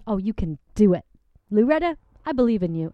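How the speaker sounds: ordinary delivery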